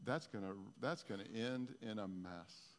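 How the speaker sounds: soft, low, slowing voice; quietly predict failure, drawing it out; voice from the past. Acted. Preacher quoting a discouraging voice from the past, low and subdued. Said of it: "You hear a voice from the past saying".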